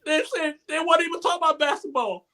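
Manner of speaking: high, fast, choked-up voice; tearful. Real, not acted. Telling the group how it moved him, tearful. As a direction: rush it out through tears. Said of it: "I'm about to get emotional".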